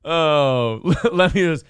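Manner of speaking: normal talking voice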